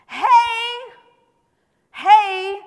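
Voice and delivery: high, loud holler; calling out to God. Acted. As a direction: shout to get God's attention.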